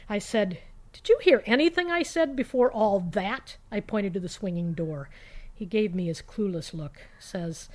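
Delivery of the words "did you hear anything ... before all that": raised, higher pitched exasperated question; exasperated demand. Retold or imitated. To her roommate, fed up he ignored her, a raised exasperated question. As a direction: make him finally listen.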